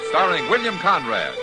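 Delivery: usual speaking voice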